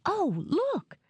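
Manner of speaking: sudden high excited cry; high excited gasp; excited squeal. A narrator's reading. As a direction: spot something thrilling and squeal. Dora spots an outfit.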